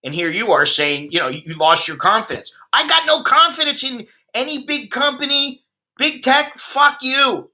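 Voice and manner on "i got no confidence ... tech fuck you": raised pitch, heated disbelief; indignant rant. Real, not acted. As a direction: spit it out like you're fed up. Ranting about big tech.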